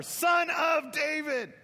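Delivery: high, loud, drawn-out plea; desperate cry. Acted. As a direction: cry it out slowly across the crowd. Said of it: "crying out all the more".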